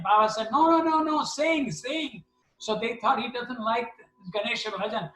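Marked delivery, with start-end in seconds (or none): 0.5-2.2 high, insistent calling-out, loud at first; exasperated correction. Retold or imitated. Guru correcting the singing devotees, exasperated. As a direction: protest urgently in a much higher voice. Acted Baba protesting to group.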